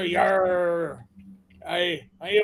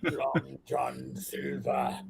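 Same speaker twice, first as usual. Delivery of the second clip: pirate voice; 6 semitones lower, 10 dB quieter, slower than usual. Acted. Pirate name in deep voice.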